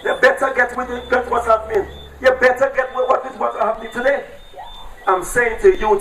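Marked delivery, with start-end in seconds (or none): none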